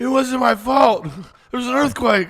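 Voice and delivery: loud, high-pitched hammed-up pleading; Belushi. Groveller making excuses after being reminded of a show, hammed-up pleading. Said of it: "Reminds me of the Belushi show".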